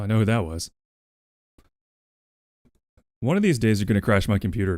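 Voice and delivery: normal talking voice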